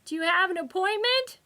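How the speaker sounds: high, loud clerk's question; shouting receptionist. A narrator's reading. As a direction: ask it loud and high over the din. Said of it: "the voice shouted over the waiting room din".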